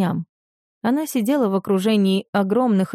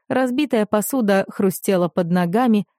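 usual speaking voice

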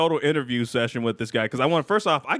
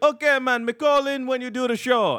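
higher mock Jamaican accent; 8 semitones higher than usual. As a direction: play a Jamaican rapper in a Jamaican accent. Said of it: "He's like".

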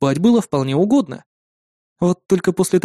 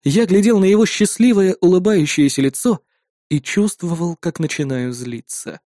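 ordinary delivery, nothing marked

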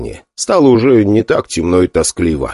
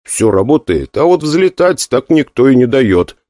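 usual speaking voice

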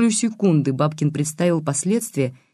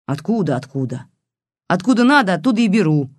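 snappish retort; 3 semitones higher than usual. A narrator's reading. Old woman rebuffing the detective, snappish. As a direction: mimic the question, then snap back. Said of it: "огрызнулась та".

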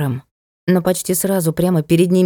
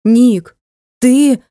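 horrified; 5 semitones higher, 6 dB louder than usual. A narrator's reading. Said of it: "в её взгляде и голосе вдруг появился ужас".

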